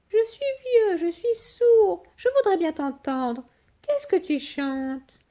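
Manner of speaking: high quavering mock old man; fake old fox. Sly fox faking deafness to the cake, high mock-old whine. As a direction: fox pretending to be old and deaf, whiny.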